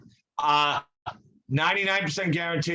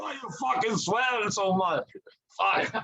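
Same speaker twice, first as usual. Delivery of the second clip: a hint of a Swedish accent, a bit higher; 5 semitones higher than usual. The old professor scolding his student for swearing, a hint of a Swedish accent. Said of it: "you have a Swedish accent too?".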